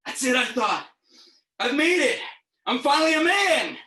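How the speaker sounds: high, loud exultant shout; mock-heroic triumph, building to a shout; triumphant cry. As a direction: proclaim it like a boy's great victory. Acted boyhood triumph after vomiting.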